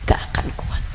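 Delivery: breathy whisper after the shout; whispered warning. Acted. Preacher echoing her warning to the congregation, hushed whisper. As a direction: let it fall to a chilling whisper. Echo after long pause.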